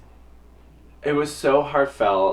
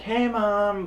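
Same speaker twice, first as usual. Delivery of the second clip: chirpy greeting; 11 semitones higher than usual. Retold or imitated. Calling mom nervously.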